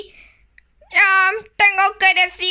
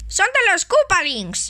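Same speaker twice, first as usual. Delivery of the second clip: ordinary talk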